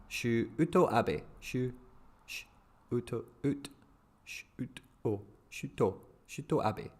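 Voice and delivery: Shuto Abe broken into short syllables, shu, to, ah-beh. Shown as a demonstration. Streamer rehearsing a Japanese name, Shuto Abe syllable by syllable. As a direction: quietly sound out "Shuto Abe" piece by piece. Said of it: "two letters as a syllable".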